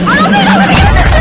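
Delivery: loud high fast panicked yell; panicking gamer. Real, not acted. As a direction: blurt it out in panic. Chased, desperate.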